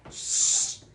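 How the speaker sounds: sharp unvoiced shush; hissed shush. A narrator's reading. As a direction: hiss to silence him. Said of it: "hissed Professor McGonagall".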